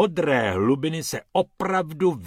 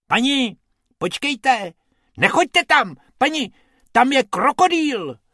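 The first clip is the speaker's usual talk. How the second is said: high, loud urgent warning; warning rabbit; 13 semitones higher, 6 dB louder than usual. Acted. Bob warns the lady about the crocodile, loud. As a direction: stop her from going in. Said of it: "varoval jí Bob".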